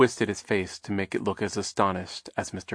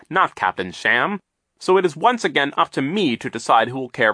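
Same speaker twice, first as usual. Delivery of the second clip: stern denunciation; 5 semitones higher, 6 dB louder than usual. A narrator's reading. Banker denounces villain.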